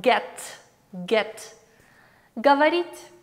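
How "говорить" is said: Говорить said as gavarit, rising at the end; Russian word demo. Shown as a demonstration. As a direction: model Говорить for learners. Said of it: "Говорить is to speak".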